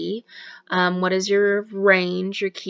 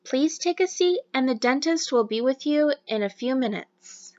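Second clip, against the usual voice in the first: the whole sentence pitched up high; high key; 6 semitones higher than usual. Shown as a demonstration. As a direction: read the whole line higher. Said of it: "Now, high key".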